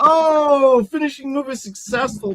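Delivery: high, loud drawn-out "Oh"; excited announcer. Acted. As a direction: call it like a ringside commentator. Game master calls a big move.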